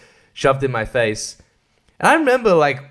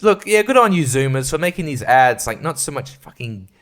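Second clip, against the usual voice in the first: normal talking voice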